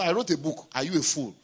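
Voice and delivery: normal talking voice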